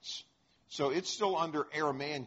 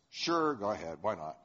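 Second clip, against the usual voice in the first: offhand approval; 5 semitones lower than usual. Retold or imitated. Acting out prophet's insincere approval to king.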